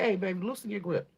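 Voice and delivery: a touch of an otherworldly voice, tender then warning; lightly voiced Spirit warning the client; voicing Spirit. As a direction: speak as Spirit, coaxing then stern. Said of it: "Spirit say".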